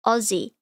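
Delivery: Aussie with a z, "OZ-ee"; AUSSIE with a buzzed z; correct Australian pronunciation. Shown as a demonstration. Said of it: "Not Aussie".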